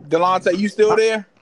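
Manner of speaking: loud call to a guest on the line. Real, not acted. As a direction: raise your voice to check someone's still there. Host checking on remote guest.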